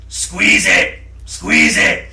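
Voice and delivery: low, loud barked order from Bull; mock gruff yell. Bull barking orders at the bleeding wrestler, gruff yell. Bull yelling at him in cage.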